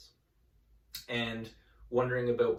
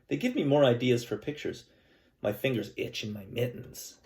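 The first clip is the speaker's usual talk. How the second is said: louder, gentle read-aloud storytelling voice; story narration; 3 semitones higher, 8 dB louder than usual. Acted. Teacher reading a picture book to her class, storytelling voice.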